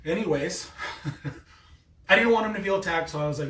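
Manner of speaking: ordinary delivery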